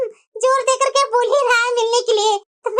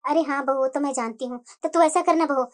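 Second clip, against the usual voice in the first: put-on much lower, softer voice of an old mother; elderly mother-in-law; 10 semitones lower, 4 dB quieter than usual. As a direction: speak low and soft like a kindly mother-in-law. Mother-in-law speaking to daughter-in-law. (बूढ़ी सास की धीमी, गहरी आवाज़)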